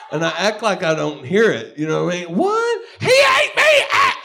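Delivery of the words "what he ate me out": very high shouted disbelief; mock shout. Pretending not to hear the woman, shouted high. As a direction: yell it like you can't believe it.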